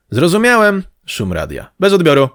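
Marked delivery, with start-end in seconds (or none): 0.1-0.8 radio call. Acted. Reply over a noisy radio. 1.8-2.3 high, loud sign-off; radio call. Acted. Signing off over the radio.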